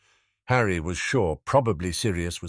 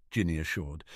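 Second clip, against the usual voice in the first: usual speaking voice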